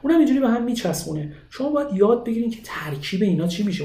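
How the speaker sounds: ordinary delivery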